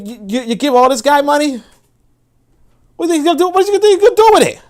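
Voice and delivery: can't believe anyone would hand him money; rising outraged what are you gonna do; exasperated disbelief. Real, not acted. Exasperated rant about the money.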